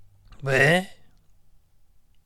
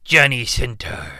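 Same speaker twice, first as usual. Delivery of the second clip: ordinary delivery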